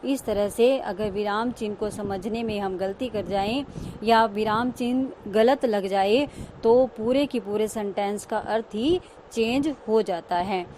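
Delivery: ordinary talk, nothing marked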